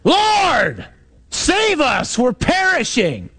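high-pitched, drawn-out desperate yell; panicked shout, stretched out to carry; yelling over wind. Acted. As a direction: call it to the back of a boat in a storm. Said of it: "one of us yells to the back of the boat".

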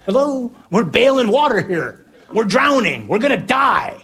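high, exasperated comic yelling; panicked shouting. Acted. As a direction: holler that the boat is sinking. Acted panic of drowning disciples.

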